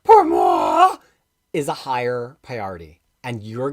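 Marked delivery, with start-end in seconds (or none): 0.0-1.0 high, loud French flourish on pour moi; a playful French aside; mock French. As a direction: toss off pour moi in a hammy French accent.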